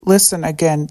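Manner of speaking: ordinary delivery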